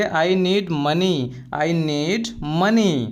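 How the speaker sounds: slow, carefully spelled-out English phrase; slow and clear classroom model phrase; slow teaching demo. Shown as a demonstration. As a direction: say each word slowly for the learner. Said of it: "तो आप बोलेंगे".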